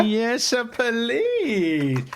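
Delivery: high gleeful squeal with a laugh; excited. Real, not acted. Co-host gushing about a favourite pairing, excited squeal. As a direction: squeal with delight. Thrilled by Hela and Shang-Chi.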